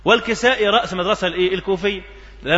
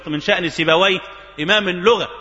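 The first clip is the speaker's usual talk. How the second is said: ordinary delivery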